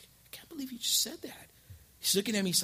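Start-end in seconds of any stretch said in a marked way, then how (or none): 0.0-1.5 hushed, unvoiced disbelief; husband whispering in shock; stunned whisper. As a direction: whisper it like the wind was knocked out of you.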